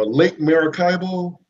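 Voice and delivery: usual speaking voice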